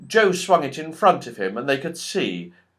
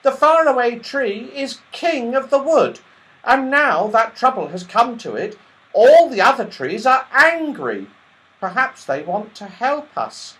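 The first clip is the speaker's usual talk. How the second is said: much higher, quick little squirrel voice; tiny squirrel voice; 11 semitones higher, 7 dB louder than usual. Acted. The red squirrel warns Joe the goblins took everyone, tiny and quick. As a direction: chatter like a tiny worried squirrel.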